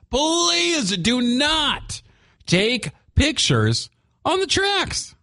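high, slow, drawn-out plea; pleading emphasis. Real, not acted. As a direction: stretch "please do not" slowly. Pleading with listeners.

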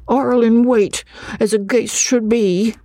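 put-on high-pitched, assured village child; confident little boy. A narrator's reading. Schoolboy describes the ghost, confident beyond his years. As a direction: pipe it up high, sure of every word. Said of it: "with a confidence beyond his years".

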